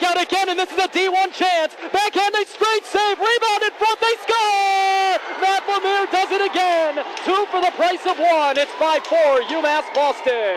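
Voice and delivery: high, excited goal call; long goal yell. Real, not acted. Goal call in hockey play-by-play.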